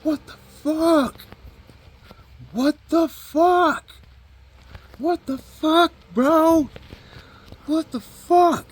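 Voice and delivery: very high falsetto shouting; repeated falsetto yells, stretched out. Retold or imitated. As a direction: mimic someone freaking out, loud and far up in pitch. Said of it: "what M G K did, he was like".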